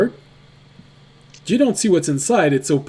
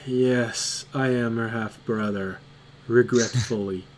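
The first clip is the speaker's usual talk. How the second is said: lower, softer half-orc grumble; weary half-orc; 5 semitones lower, 6 dB quieter than usual. Acted. Half-orc answers the newcomer with low, soft reluctance. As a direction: say it like a tired big brother. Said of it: "the guy looks at you and says".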